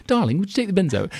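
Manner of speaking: sweet wife asking. Retold or imitated. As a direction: ask sweetly like a charming wife. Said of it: "they'll say".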